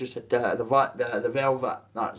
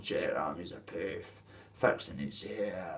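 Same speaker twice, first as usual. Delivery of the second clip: mocking gossip; 5 semitones lower, 5 dB quieter than usual. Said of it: "people will be going".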